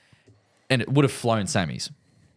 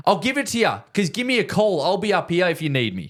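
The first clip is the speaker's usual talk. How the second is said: smug offer; 9 semitones higher than usual. Retold or imitated. Golfer offering his number to mate, high and smug. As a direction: play it high and self-satisfied. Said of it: "Jason's like".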